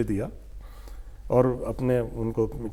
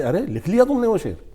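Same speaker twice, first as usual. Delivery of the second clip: surprised; 6 semitones higher, 3 dB louder than usual. Retold or imitated. Director amazed the couplet is done.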